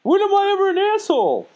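very high falsetto mimicry; mock protest. Teasing a friend about being an asshole, mock-innocent falsetto. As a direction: squeal it in a high mocking voice.

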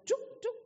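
short, high "tuk, tuk"; little high-pitched staccato sound effect; imitated jerky parking noise. Imitates clumsy parking back and forth.

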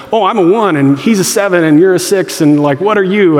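mock Enneagram talk. Mocking Enneagram chatter.

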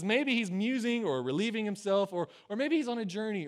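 playful acted jeering; mocking. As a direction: taunt them with offhand guesses. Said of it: "he's making all this mockery towards them".

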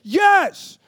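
high, loud excited yell; cheering shout. Retold or imitated. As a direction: shout it like a fan on his feet. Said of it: "I'm just like".

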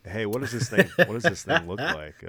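giggling amazed question; laughing awe. Retold or imitated. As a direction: ask through a laugh. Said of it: "And then I'm like".

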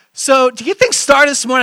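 usual speaking voice

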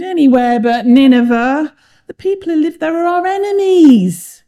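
loud, high-pitched complaint; groaning. A narrator's reading. Jonah objects to going among his enemies, groaning. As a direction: whine it loudly in a groaning protest. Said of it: "he groaned".

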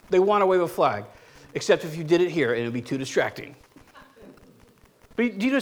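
usual speaking voice

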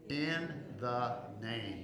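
slow, spaced-out words; congregational prompt. Shown as a demonstration. Preacher leading congregation to say it with him, slowly. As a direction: say it word by word for others to join. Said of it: "Say it with me".

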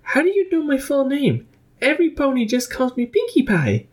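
very high, astonished pony voice; surprised. A narrator's reading. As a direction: gasp it out amazed. Startled Pinkie Pie asks.

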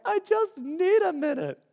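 high, slow mock wife voice; wife pleading from the bathroom, high voice; imitating the wife. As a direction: squeak it out like his wife behind the door. Wife's voice imitated.

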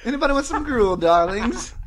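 high, loud mock posh offer; camp character. Quoting Ralph Fiennes to friends, high and loud. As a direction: sing it out like a fussy concierge. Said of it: "he's like".

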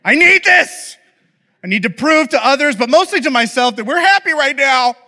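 desperate inner voice. Retold or imitated. Dad's inner voice craving a happy photo, desperate plea. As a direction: ham up a desperate need to look happy.

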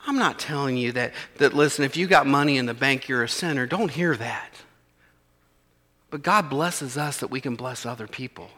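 gentle reassurance. Real, not acted. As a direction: reassure them quietly that money is no sin. Soft pastoral aside.

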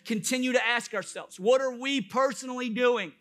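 high, loud pulpit delivery; full-force preaching. Acted. Pastor urging believers to populate heaven, impassioned preaching. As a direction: drive each phrase home to the congregation.

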